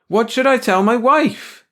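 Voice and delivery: high, loud, drawn-out shout. A narrator's reading. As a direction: call after people who are leaving. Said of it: "George shouted".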